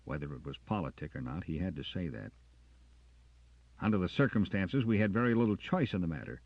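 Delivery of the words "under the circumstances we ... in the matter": louder, fast heated outburst; fiery burst. A narrator's reading. Jackson bursting at the sergeant, louder and fast. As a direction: snap it out with old fire. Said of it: "showed some of his old fire in that burst".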